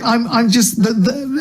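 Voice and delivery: usual speaking voice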